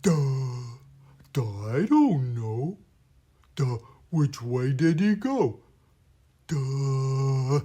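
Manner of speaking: dopey open-throated puppet voice, drawn out; slow, dull character. Shown as a demonstration. Teacher demonstrating puppet lines, slow dull voice. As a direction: play it dim-witted, throat wide open. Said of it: "opening up the back of your throat and using lower tones".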